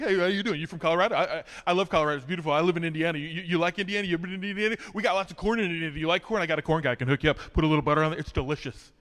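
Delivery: rapid, rambling, nervous small talk; nervous fast chatter. Retold or imitated. To the girl tying his rope, nervous fast chatter. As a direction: rattle off questions without stopping. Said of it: "I get super chatty. I talk real fast".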